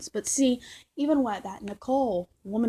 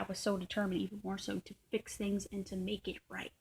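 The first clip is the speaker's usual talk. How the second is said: whisper; 8 dB quieter than usual. Real, not acted. Quiet admission of determination.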